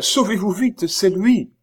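high, urgent, panicky warning; alarmed cry. A narrator's reading. Oliva warns the stranger her lover is here, alarmed cry. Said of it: "s'écria-t-elle".

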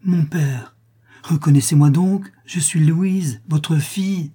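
high, pleading outcry; tearful cry. A narrator's reading. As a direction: cry out imploringly, on the edge of tears. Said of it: "s'écria-t-elle en fondant larmes".

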